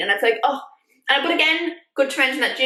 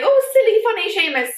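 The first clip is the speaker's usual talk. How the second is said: high, mock-cute sing-song; mocking fond voice; 10 semitones higher than usual. Vlogger mocking how the films frame Seamus, in a high mock-cute voice. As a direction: parrot the cutesy framing of a clumsy boy. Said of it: "it's portrayed as, like".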